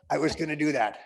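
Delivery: usual speaking voice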